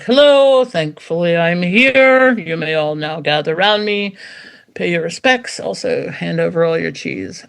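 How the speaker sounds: grand, loud royal announcement; royal proclamation. Pretend. Shepherd dog announcing its arrival, regal and loud. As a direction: proclaim it like a pompous monarch. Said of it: "announce that they are there like some royal proclamation".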